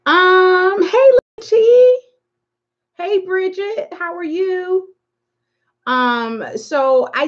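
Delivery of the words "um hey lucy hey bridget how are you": squealy high hello to friends; high-pitched excited greeting. Real, not acted. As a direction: greet them bright and high, drawn out. Greeting viewers joining the stream.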